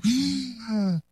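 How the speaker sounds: a soft gasp like a newborn's first breath. Shown as a demonstration. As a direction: breathe in like a baby being born. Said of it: "dijeron el nombre del Señor". (jadeo suave de recién nacido)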